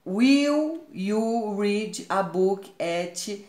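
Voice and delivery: slow, clear teacher reading of the question; slow rising classroom question; slow model reading. Shown as a demonstration. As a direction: read each word out slowly for the class. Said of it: "o will vem no início da frase".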